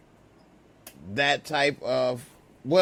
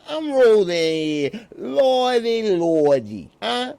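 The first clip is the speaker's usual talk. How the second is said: loud, high, hammed-up New Yorker; New York accent; 5 semitones higher, 6 dB louder than usual. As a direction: pester them like a pushy, shouting New Yorker. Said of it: "That's how you say it over there".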